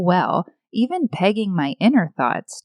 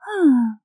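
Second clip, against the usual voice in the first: high, falling contented sigh; 8 semitones higher than usual. Shown as a demonstration. Showing the sighs God tells apart, a contented sigh. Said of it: "every contented sigh".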